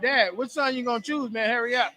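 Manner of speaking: hammed-up fan shouting at the dad; mock impatient fan. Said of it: "a fan is gonna say something like".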